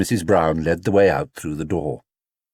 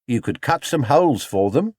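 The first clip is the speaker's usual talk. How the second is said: higher, lightly voiced young shop boy; a helpful lad's eager idea; Albert; 4 semitones higher than usual. A narrator's reading. Albert suggesting holes in the hat, eager young voice.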